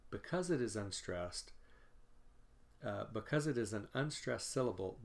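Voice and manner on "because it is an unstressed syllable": normal talking voice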